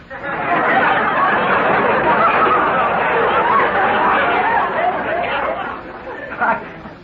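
long high breathy laugh. Acted. Luigi laughing helplessly at his mother's news. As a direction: laugh and laugh, unable to stop.

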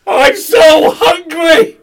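high, loud, slow lament; wailing shout. Acted. Character bemoans hunger, wailing shout. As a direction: drag it out in a loud wail.

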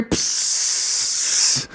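long hissing spray sound; pepper spray noise. Acted. Officer's pepper spray fires everywhere, hissing. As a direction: imitate an aerosol going off.

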